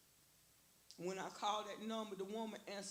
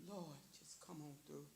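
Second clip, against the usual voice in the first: mumbled prayer; 6 semitones lower, 10 dB quieter than usual. Retold or imitated. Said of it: "all I mumble to myself".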